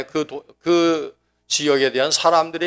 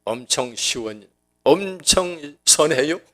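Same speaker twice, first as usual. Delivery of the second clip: slow Chungcheong drawl; slower than usual. Retold or imitated. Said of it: "저희 집사람이 얘기할 때는".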